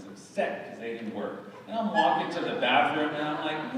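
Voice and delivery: ordinary delivery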